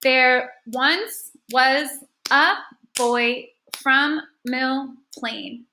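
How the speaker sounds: very slow, one syllable at a time; measured counting-out read; clapped-out syllables. Shown as a demonstration. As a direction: read it syllable by syllable with gaps between. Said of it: "let's go ahead and clap it out".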